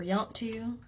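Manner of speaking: "yont to" run together from "you want to"; Southern contraction. Shown as a demonstration. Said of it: "I heard people say".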